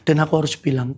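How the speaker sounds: ordinary talk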